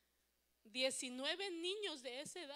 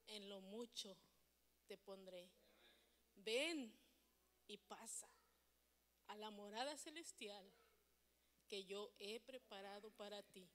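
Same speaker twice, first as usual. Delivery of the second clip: solemn divine welcome; 6 semitones lower, 6 dB quieter than usual. Acted. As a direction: pronounce it gravely, phrase by phrase, with long pauses. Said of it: "va a decir".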